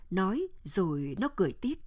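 ordinary delivery

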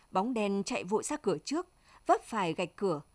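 plain reading voice

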